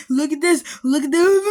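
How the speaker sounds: loud, high imitation of an excited kid; hammed-up showing-off kid; mock attention-seeking. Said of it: "It was like".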